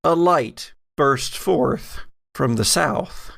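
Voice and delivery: ordinary talk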